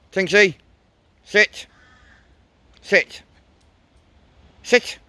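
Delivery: firm high command to a dog. Real, not acted. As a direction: tell the dog to sit.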